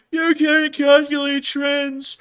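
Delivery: loud, high, drawn-out mock complaint; mock them slowly and shrilly high; mocking critic. Imitating rude commenters about the video, mocking. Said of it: "in a mocking tone".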